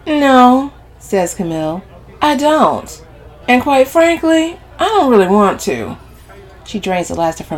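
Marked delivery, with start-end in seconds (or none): 0.1-0.7 loud, high no; indignant denial; emphatic refusal. A narrator's reading. Camille flatly refuses to agree. 2.2-6.0 high, loud refusal; haughty, pitched-up dismissal; defiant. A narrator's reading.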